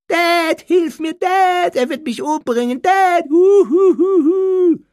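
very high, loud mock pleading; hammed-up shrieks ending in boo-hoo; mock wailing. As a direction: wail for Dad like a crybaby. Dudley mockingly imitates Harry's cries.

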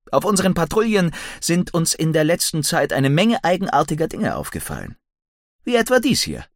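lightly voiced eager young man; Billy. A narrator's reading.

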